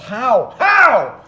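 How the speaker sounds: shouting. Real, not acted. Rolled a natural one.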